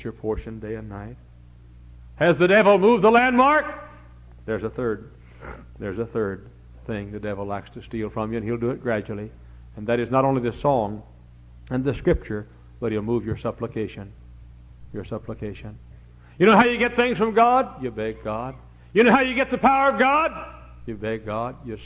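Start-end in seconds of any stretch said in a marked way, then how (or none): 2.2-3.7 loud, high preaching cry; preaching shout. Real, not acted. Preacher exhorting congregation. 16.4-17.8 loud, high preaching cry; preaching shout. Real, not acted. Preacher exhorting congregation. 18.9-20.3 loud, high preaching cry; preaching shout. Real, not acted. Preacher exhorting congregation.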